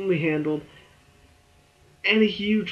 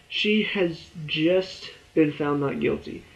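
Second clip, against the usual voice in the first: ordinary talk, nothing marked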